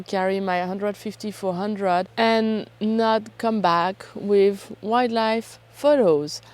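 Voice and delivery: ordinary talk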